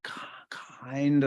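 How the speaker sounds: slow, halted k-sounds, half whispered; halting stutter demo. Shown as a demonstration. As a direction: stumble on each k- before the word kind. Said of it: "her articulation is k".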